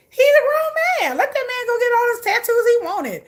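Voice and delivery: high-pitched, loud retold protest; exasperated defending. Retells defending him against critics.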